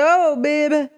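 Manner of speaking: high, slow diva send-off; mock regal goodbye, high and drawn out; sassy dismissal. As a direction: brush him off like a queen. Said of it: "the Goddess Nikita said".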